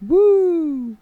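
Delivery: high, drawn-out "woo"; celebratory whoop. Pretend. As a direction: whoop for the long-awaited router. Celebrates finally getting the router.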